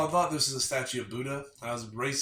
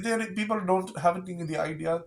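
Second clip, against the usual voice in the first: hammed-up Indian accent, a loud high burst on the start; mock Indian accent; 4 semitones higher, 5 dB louder than usual. Podcast friend explains two kinds of Indian in a mock Indian accent. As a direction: play an Indian narrator with a put-on accent. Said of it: "do the accent".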